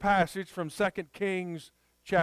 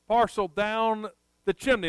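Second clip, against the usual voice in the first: ordinary talk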